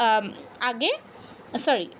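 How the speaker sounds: ordinary talk, nothing marked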